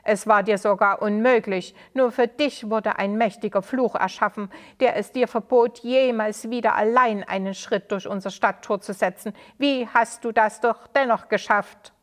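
loud, indignant questioning; angry townsman demanding answers from the stranger; angry accusation. Acted. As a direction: confront him before the crowd.

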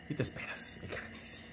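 hushed whisper, no voice at all. Real, not acted. Tutorial host telling viewers to wait for the reboot, whispered. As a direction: drop to a secret whisper.

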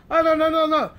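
loud, high string of protests; imitating an insistent correction; hasty denial. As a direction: cut off the misunderstanding, loudly. Friend hastily correcting him.

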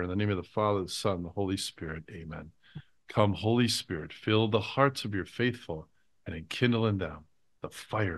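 low, hushed prayer voice; soft closing blessing. Acted. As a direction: pray it softly, reverently.

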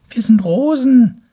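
very high, loud; proud little flower voice; roses' voice. Acted. Roses introduce themselves, very high voice. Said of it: "sagten die Rosen".

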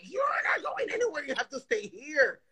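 high-pitched, exasperated retort, imitating an argument; retold scolding. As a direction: replay telling off a restless husband, pitch jumping up. Retold quarrel with her husband.

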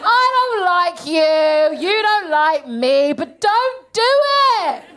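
high, stretched-out wail of protest; horrified plea. Acted. Comic begging a politician not to volunteer, high drawn-out protest.

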